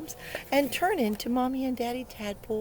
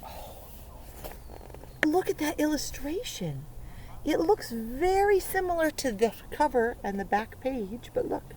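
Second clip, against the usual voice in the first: ordinary talk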